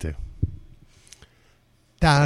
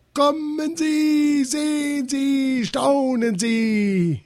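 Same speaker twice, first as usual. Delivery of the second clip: very high, slow showman's call; ringmaster's announcement; much slower than usual. A narrator's reading. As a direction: stretch every invitation out grandly. Ringmaster starts his speech again.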